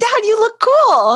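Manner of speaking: high, drawn-out gushing; excited praise. Retold or imitated. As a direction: gush over him like a proud kid. Quoting herself hyping dad.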